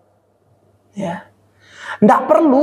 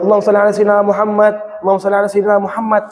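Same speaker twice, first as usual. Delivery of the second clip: rushed salawat; much faster than usual. Shown as a demonstration. Rushed salawat shown as example.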